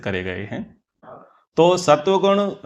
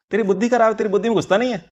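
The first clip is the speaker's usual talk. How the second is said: higher-pitched example of scolding; mock insult; 6 semitones higher, much faster than usual. Teacher voicing a hurtful remark as example, mock insult. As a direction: imitate someone putting another person down. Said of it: "ये बोलना भी क्या हुआ?".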